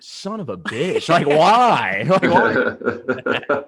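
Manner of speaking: drawn-out curse rising into a loud wailed "why"; mock despair, half-laughing on the last cry; exasperated outburst. Acting out bullpen reaction to a double.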